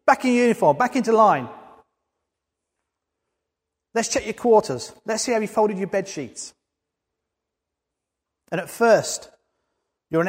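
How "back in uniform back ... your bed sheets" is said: bawled orders. Retold or imitated. Sergeant major barking orders at the ex-soldier, mock bawl. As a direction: order him back into line, hammed up. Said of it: "and bawls at you".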